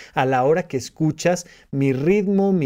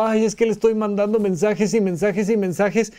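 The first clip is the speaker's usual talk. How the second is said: complaining man; 5 semitones higher than usual. Retold or imitated. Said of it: "Un hombre está diciendo".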